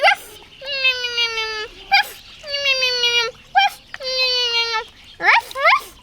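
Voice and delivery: high excited mock barks; playful high ruffs; puppy barks. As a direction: yap like an eager puppy. Dogs bark at the cake.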